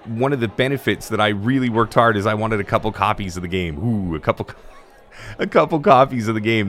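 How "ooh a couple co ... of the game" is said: laughing. Real, not acted. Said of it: "laughs at own stumble".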